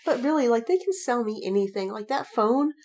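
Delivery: ordinary delivery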